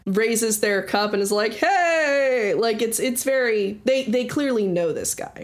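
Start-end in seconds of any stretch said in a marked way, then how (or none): 1.5-2.5 high, drawn-out welcoming cheer; crowd's cheer. Acted. Tavern patrons greeting a regular, high cheer.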